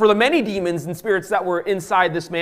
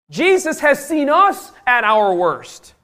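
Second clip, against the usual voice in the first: slow, high, loud proclamation; full-force pulpit declaration; impassioned preaching; 10 semitones higher, 8 dB louder, much slower than usual. Real, not acted. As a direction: cry it out to the whole congregation, drawn out.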